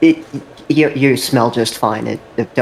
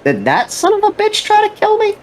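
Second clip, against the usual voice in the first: high, frantic squeal of alarm; panicked Yamato; 10 semitones higher than usual. Retold or imitated. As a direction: squeak it out like he just realised someone tried to kill him. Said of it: "It's like".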